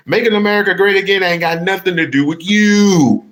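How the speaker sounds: normal talking voice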